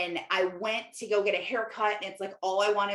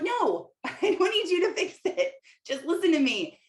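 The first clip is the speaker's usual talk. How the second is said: much higher, laughing, exasperated no; exasperated protest; 9 semitones higher than usual. Retold or imitated. Wife tells her fixer husband to just listen, higher, laughing, exasperated.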